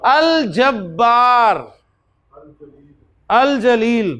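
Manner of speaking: drawn-out Arabic word; slow recitation. Shown as a demonstration. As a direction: say it slowly for learners. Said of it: "जीम". (धीमा उच्चारण)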